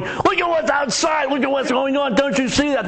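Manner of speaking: high, frantic alarm; panicky. Retold or imitated. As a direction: mimic someone whose hair is on fire. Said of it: "when they're going".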